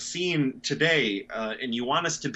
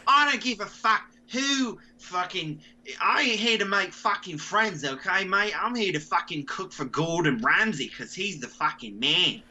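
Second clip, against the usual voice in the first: put-on higher, cocky British accent; brash British accent; 6 semitones higher than usual. A cocky contestant bragging about Gordon Ramsay, British accent. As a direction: play a mouthy British kitchen contestant. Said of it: "The Great Brent Voice Off".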